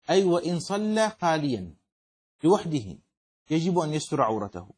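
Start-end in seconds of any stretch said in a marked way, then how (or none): none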